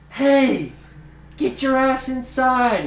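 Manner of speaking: put-on high, loud yell at the kids; screaming woman. Tweaked-out woman orders kids inside, mock screaming. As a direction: holler it, drawn out, mimicking an angry woman. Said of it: "same girl who was screaming at us".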